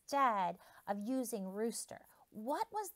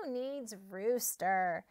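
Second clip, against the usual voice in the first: smug farm animals; 2 semitones lower, slower than usual. Acted. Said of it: "the farm animals said".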